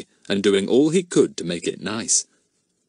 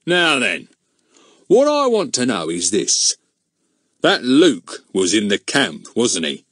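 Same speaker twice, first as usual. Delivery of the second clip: pompous policeman; 2 semitones higher, 4 dB louder than usual. A narrator's reading. Goon interrogates Fatty.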